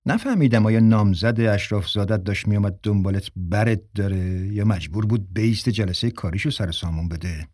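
plain reading voice

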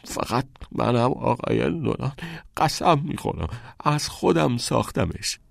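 high, strained croak; rasping with pain. A narrator's reading. Beaten pupil swearing to the principal, rasping in pain. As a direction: plead hoarsely through the pain. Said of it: "با صدای خرخری از درد".

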